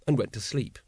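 ordinary delivery, nothing marked

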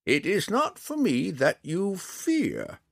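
put-on high, drawn-out old ranger voice; slow, deliberate. A narrator's reading. Old ranger telling the drow what he fears, slowly. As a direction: space the words out slowly, pitched high. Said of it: "Montaleo said slowly".

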